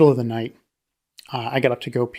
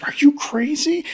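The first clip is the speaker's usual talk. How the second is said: high, slow disbelief; incredulous; 11 semitones higher, slower than usual. Retold or imitated. Dad staring at grandfather, high-pitched disbelief. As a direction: draw out the question in shock. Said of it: "my dad looked at him and goes".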